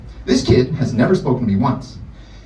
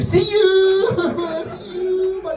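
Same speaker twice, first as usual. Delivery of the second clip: very high, bright escape; cheerful goodbye while bolting out the door; hasty goodbye; much slower than usual. Retold or imitated. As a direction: chirp it while fleeing. Escaping class as bell rings.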